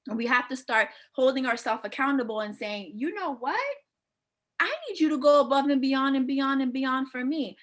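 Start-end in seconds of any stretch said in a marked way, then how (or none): 3.0-7.6 raised pitch, then an insistent run of "beyond"; acted demand. Woman telling her man to go above and beyond, insistent. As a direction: put your foot down, piling it on. Acting out holding a man accountable.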